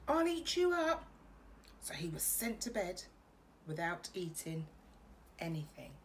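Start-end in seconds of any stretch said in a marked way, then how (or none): 0.1-1.0 high, loud growly threat; playful threat. A narrator's reading. Max shouting back at his mother. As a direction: roar it like a wild boy. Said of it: "and Max said".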